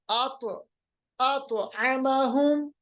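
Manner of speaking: slow आत आमाहुम, heavy t bounced as "ato"; qalqalah. Shown as a demonstration. Teacher gives an example, आत with bouncing heavy t. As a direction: echo the t of आत, stretch आमाहुम. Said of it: "इस पे कलकला होगा".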